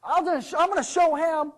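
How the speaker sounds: loud, high, aggrieved rant; mad. Acted. Servant tells the stranger about his master, ranting angrily. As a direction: rant at full volume about being slighted. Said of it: "what I'm mad about".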